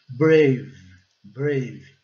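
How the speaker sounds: BRAVE with A said as "ay", E silent; silent final E. Shown as a demonstration. Teacher modelling "brave" for Brazilian learners, silent final E. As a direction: say BRAVE slowly, ending right on the V. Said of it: "O E silencioso e o A recebendo o som de A".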